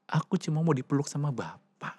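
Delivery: put-on soft whisper of a child's wish; whispered plea. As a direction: breathe it like a hurt child. Child's plea retold softly.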